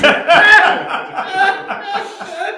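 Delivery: long high-pitched laughing fit. Real, not acted. Storyteller laughing at the punchline, long high laugh.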